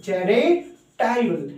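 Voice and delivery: normal talking voice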